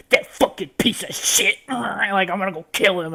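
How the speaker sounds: half-hammed angry snarl; angry outburst. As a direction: spit it out like an enraged hero. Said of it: "swings over there all pissed off".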